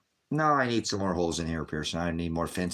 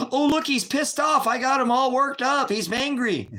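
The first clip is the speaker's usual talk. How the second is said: much higher and louder, a gleeful mocking squeal; mocking falsetto; 6 dB louder than usual. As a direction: taunt the troll in a high, sing-song gasp. Mocking a troll he riled up.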